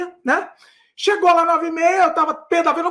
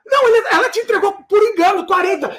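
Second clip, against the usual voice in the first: higher, loud insistent yell; angry demand; 4 semitones higher, 3 dB louder than usual. Retold or imitated. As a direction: demand the money back. Exigindo o dinheiro de volta. (exigindo aos gritos o dinheiro de volta)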